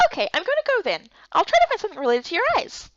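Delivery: high, quick chirpy voice; bubbly little companion; perky girl character. Acted.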